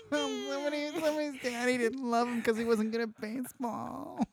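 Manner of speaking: high, squeaky taunting voice; sing-song high mockery of a friend; mock teasing voice. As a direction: tease him in a silly high voice.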